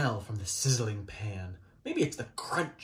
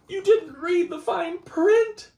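much higher, louder, slow gasp of alarm; horrified realization to the kids; shocked alarm; 7 dB louder than usual. A narrator's reading. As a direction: cry out in storybook panic. Alarmed discovery.

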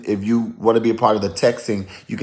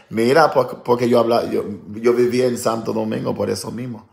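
usual speaking voice

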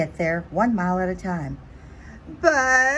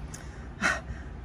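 a breathy little cry of defeat; sobbing sigh; 11 dB quieter than usual. Acted. Butterfly alone and lost, soft defeated sob.

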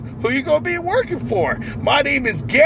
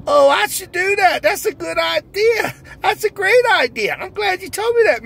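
overjoyed new hire; 7 semitones higher than usual. Acted. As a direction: squeal it out like the happiest man alive. Character answers the interviewer.

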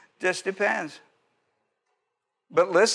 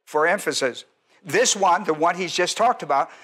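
ordinary talk

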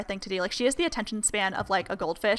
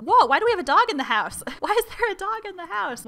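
louder, then much higher laughing disbelief; startled surprise; 9 semitones higher, 6 dB louder than usual. Real, not acted. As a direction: react to an unexpected dog in the house. Finds a dog in the house.

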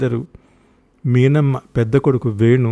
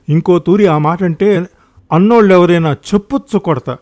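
louder, indignant telling-off; angry scolding; 4 semitones higher, 6 dB louder than usual. Acted. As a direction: scold and threaten, voice raised. Acted quarrel, threat to hit. (కోపంగా మందలిస్తూ)

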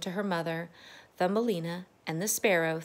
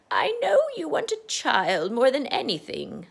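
high, loud kindly witch voice; a friendly old witch leaning in; 7 semitones higher, 6 dB louder than usual. Acted. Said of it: "said the witch".

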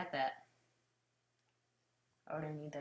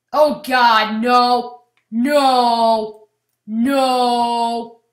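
panicked shouting; 5 semitones higher, 17 dB louder, much slower than usual. Real, not acted. Ship being destroyed in game.

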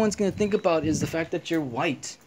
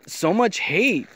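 slow, drawn-out "so much hate" with each word stretched; much slower than usual. Shown as a demonstration. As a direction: let "so much hate" drag out slowly, heavy with disapproval. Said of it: "I just say it like that".

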